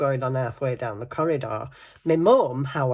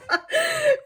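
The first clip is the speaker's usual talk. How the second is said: very high, crying laughter; real squeaky laughing fit; 3 dB louder than usual. Real, not acted. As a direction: laugh till near tears. Laughing at slippers story.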